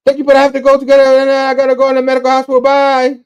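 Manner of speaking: high, loud, racing babble; rushed excuse. Retold or imitated. Spider-Boy bolting from his job interview, rushed and high. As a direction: blurt it all out at top speed in one high-pitched rush.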